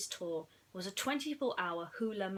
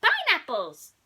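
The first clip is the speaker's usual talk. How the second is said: high, loud alien shout; 10 semitones higher, 12 dB louder than usual. Acted. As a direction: shout it with glee. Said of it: "he shouted".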